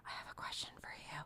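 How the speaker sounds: hushed, breathy secret question; whispered drunken question. Retold or imitated. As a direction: whisper it like a tipsy conspirator at two in the morning. Drunk whisper quote at 2am.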